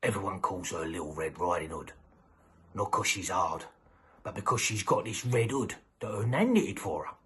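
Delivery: usual speaking voice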